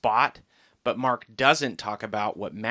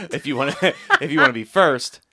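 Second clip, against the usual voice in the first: laughing through it, higher and louder; amused self-correction; 4 semitones higher, 8 dB louder than usual. Real, not acted. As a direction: restart through real laughter at your own slip. Laughs at own reversed slip.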